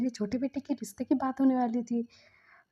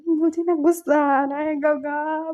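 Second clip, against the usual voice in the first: crying; 4 semitones higher, 8 dB louder, slower than usual. Retold or imitated. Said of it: "बोल के वो रो रहा था".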